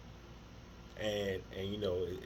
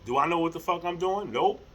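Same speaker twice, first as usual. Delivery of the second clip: emphatic admission; 2 semitones higher, 8 dB louder than usual. Real, not acted. Admits he has no idea.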